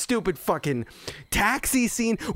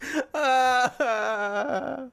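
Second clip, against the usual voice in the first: despairing laugh; 9 semitones higher than usual. Real, not acted. Despairing laugh after the movie.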